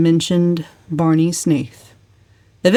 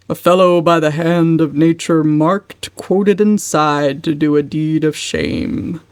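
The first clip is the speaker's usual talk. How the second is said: slow, grand recitation; declaiming; slower than usual. A narrator's reading. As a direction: declaim it like verse. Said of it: "declaimed Uncle James".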